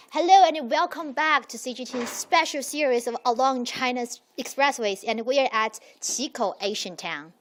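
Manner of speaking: upbeat presenter welcome; TV host intro. Acted. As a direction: open the show like a travel host. Host opens the episode.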